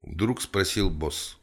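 ordinary delivery, nothing marked

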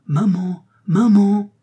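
put-on little boy calling mother at full strength; shouting. A narrator's reading. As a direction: holler for mum, building in pitch and volume. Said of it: "cria-t-il de toutes ses forces".

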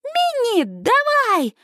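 high-pitched cheering yell; squealed cheer from the stands; excited yell. A narrator's reading. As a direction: cry out high to urge the child on. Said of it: "вопит".

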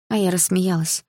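ordinary delivery, nothing marked

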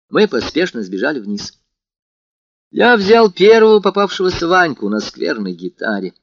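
plain reading voice

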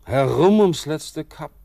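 laut und hoch, entrückt; ekstatisch. Acted. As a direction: wie im Rausch ausrufen. Ecstatic drifting vision. (entrückt und laut)

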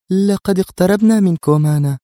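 high, loud, tense remark; openly tense. A narrator's reading. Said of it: "فغمغمت جيهان دون أن تحاول إخفاء توترها". (بتوتر ظاهر)

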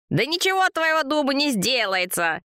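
high-pitched brush-off; dismissive. A narrator's reading. Anton shrugs off Vova's complaint, high dismissive retort. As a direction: wave it away, voice rising high. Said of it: "отмахнулся Антон".

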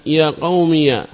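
يا قومي said as "ya qawmiya"; opened final ya. Shown as a demonstration. Teacher giving another second-dialect example, يا قومي ending in -iya. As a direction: end يا قومي on a short a. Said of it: "إثباتها مفتوحة".

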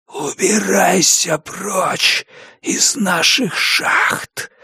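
slow, hissing ghostly warning; hissing whisper. A narrator's reading. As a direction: hiss the threat slowly. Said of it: "Свистящий шёпот становился громче".

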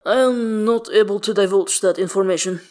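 lightly voiced guarded professional; formal bodyguard. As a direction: decline politely and firmly in character. Bodyguard formally declines to answer.

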